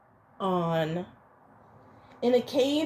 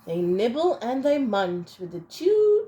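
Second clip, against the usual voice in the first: ordinary delivery, nothing marked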